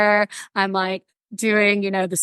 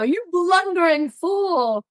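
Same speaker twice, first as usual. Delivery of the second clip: higher, slow, hammed-up scorn; mock scornful insult; 9 semitones higher, slower than usual. To a friend who fell, hammed-up slow scorn. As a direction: sneer it out like a mock villain. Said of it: "and I go".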